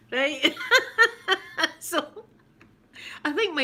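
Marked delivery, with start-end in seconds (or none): 0.6-1.7 loud high laugh breaking out; high burst of laughter. Real, not acted. Laughing at own story.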